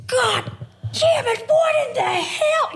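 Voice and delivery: high exasperated yell, acted out; husband's yell. Wife imitating her husband losing it with the kids, yelling. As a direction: shout it as an outraged dad, drawn out at the end. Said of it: "I hear Matt yell".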